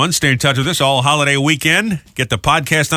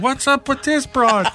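much higher, slower teasing mock voice; mock pirate crew; 10 semitones higher, slower than usual. Pirates mocking an overly polite pirate, teasing mock voice. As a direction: play the puzzled pirates making fun of her. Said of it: "They're like".

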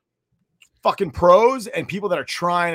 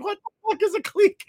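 confused question. Retold or imitated. Retold own confused question.

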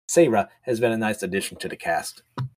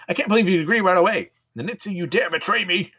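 mock outrage; 6 semitones higher than usual. Betrayed villain.

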